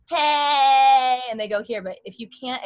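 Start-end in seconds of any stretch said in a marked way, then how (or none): 0.1-1.2 "Hey" shouted from the chest, much louder; a wrong, pushed-back yell. Shown as a demonstration. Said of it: "the biggest thing people go".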